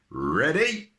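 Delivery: loud, high "Ready?" to the team; shouting. A narrator's reading. Percy shouting to the rescuers lined up behind him. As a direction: get everyone set to pull. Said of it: "shouted Percy".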